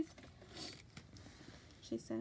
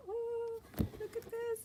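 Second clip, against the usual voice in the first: high-pitched awestruck coo; wide-eyed amazement; 11 semitones higher, slower than usual. Retold or imitated. Vlogger shows how she looks everywhere at the paper, high and amazed. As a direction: act out being dazzled, voice way up high. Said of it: "I am, like, looking everywhere".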